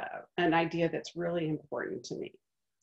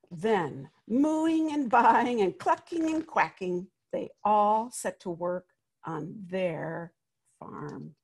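put-on lively read-aloud voice for children; story narration; 4 dB louder than usual. A narrator's reading. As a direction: tell it like a picture book to a class. Reads picture book aloud.

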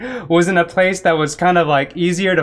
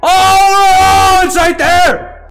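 loud scared yell; frightened shout; 12 semitones higher, 8 dB louder, much slower than usual. Real, not acted. Spots monster, shouts.

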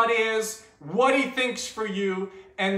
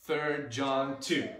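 slow, measured group recitation; verse with actions; 2 semitones lower, much slower than usual. Shown as a demonstration. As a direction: lead it phrase by phrase, leaving room for gestures. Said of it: "let's do it with some actions".

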